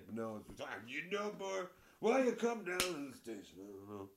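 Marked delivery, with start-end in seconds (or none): 0.9-3.6 hammed-up cop calling him boy; mock condescending cop. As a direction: talk down to him like a smug sheriff.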